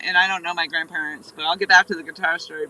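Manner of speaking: ordinary delivery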